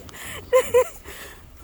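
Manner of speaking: loud high laugh; high squealing laugh. Real, not acted. Laughs after near fall.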